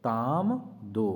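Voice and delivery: ताम दो said very slowly, stretched out; slow mnemonic. Shown as a demonstration. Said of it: "क्या दो". (धीरे-धीरे खींचकर ताम दो)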